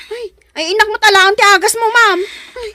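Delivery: high, loud and fast, in a panic; frantic fast cry; panicked rush. Acted. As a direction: rush the words out, frantic to fetch the medicine. Rushing for medicine.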